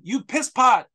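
mocking Delavina screaming at Garrett in a fake accent; mock furious scream. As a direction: ham up a woman screaming curses. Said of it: "screams at him".

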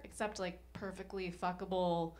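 ordinary talk, nothing marked

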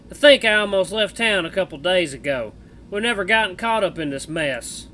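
put-on loud, drawling cowboy accent; Misha cowboy accent. Streamer voicing Misha the bat to Jenna, cowboy accent. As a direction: play the bat as a rough cowboy, American West accent. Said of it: "cowboy accent for Misha".

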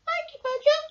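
high, squeaky thanks; high little mouse voice. A narrator's reading. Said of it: "Mouse squeaked".

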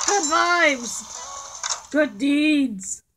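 slow, loud cheer; 1 drawn-out shout. Real, not acted. Player chanting at the villager, slow loud shout. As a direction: stretch it out and bellow.

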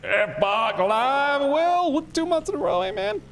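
high announcer shout-out; hype announcer. Pretend. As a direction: call it out like an MC. Thanking a subscriber on stream.

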